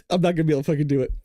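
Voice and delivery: fast, laughing mock Trump line; Trump impression. Imagining Trump phoning Iran after the bombing, fast laughing mock. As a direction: rattle it off through laughter. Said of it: "picture Trump calling them up".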